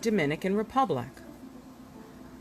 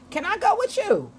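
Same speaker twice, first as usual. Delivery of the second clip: high, hammed-up pickup line; come-on line; 13 semitones higher, 4 dB louder than usual. A strange man tries his luck with her, high mock come-on. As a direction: mimic a stranger chasing after her. Unfamiliar men's follow-up line.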